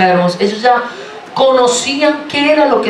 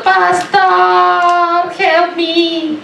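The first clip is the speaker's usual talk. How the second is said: high, sing-song whimper of "Pastor, help me"; mock a helpless sheep crying to the pastor; hammed-up whining singsong; whiny plea; 8 semitones higher, much slower than usual. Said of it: "salimos corriendo donde el pastor".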